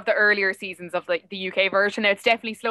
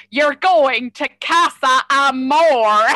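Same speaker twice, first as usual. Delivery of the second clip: put-on slow, drawn-out Longford Irish accent; 4 semitones higher, 6 dB louder, much slower than usual. Imitating Maura's Casa Amor line in a slow Longford accent. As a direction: stretch it out in a thick Midlands Irish accent. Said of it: "in her Longford accent".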